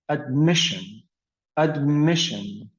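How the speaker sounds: "admission" stressed on its second syllable, ad-MI-ssion. Shown as a demonstration. Teacher showing where stress falls, "admission" stressed on MI. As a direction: land on the "mi" in "admission". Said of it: "So the stress was on the second".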